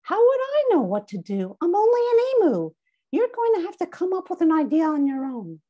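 squeaky emu voice. Acted. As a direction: play the emu dodging the question. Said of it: "I answered for Emmy".

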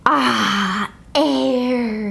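breathy relief after holding breath; relieved sigh. A narrator's reading. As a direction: gulp in the air, relieved.

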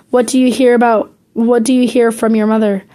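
ordinary delivery, nothing marked

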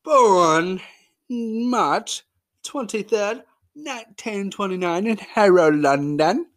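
hammed-up posh British accent, slow and far from his own voice; terrible British accent. Host introducing Bannister in a bad British accent. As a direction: put on a bad English accent. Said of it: "does not have this terrible accent that I have".

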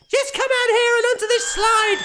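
put-on high, loud little boy calling out; child scream. A narrator's reading. Son calling father onto the slide, high loud child shout. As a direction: shout it like an excited small boy. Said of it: "screams the son".

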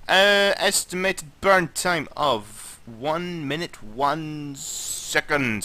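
ordinary talk, nothing marked

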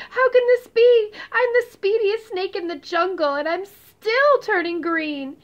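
high, loud, alarmed cry; panicked, desperate cry. Acted. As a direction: panic at the sight. Verdi panics at turning green.

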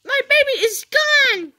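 put-on high, loud wail that the baby is gone; a child's hammed-up lost-baby alarm; distressed cry. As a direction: cry out in mock panic to the helper. Pretend mother lost her baby.

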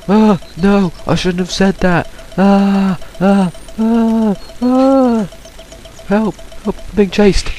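high panicked yelps; scared horror game streamer. Real, not acted. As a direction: shriek as the monster chases you. Chased by walking shell, screaming.

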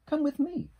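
high storybook beaver, beckoning; little beaver. A narrator's reading. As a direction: invite along in a high animal voice. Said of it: "said Little Beaver".

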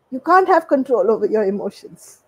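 usual speaking voice

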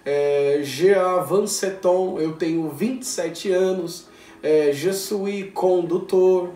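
slow, careful French phrases; slow learner French. Shown as a demonstration. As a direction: spell out the French phrases slowly. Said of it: "aprendendo a se apresentar".

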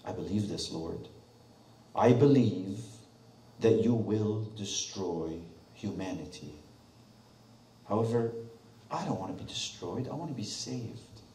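hushed, heartfelt prayer to God; sincere prayer. Acted. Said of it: "Intellectually says".

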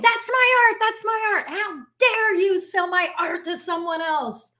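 high-pitched outraged yell, mostly retelling; retold angry yelling. Angry surfboard shaper yelling at the artist, high and heated. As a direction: mimic the furious shaper shouting his claim. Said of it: "and he's yelling".

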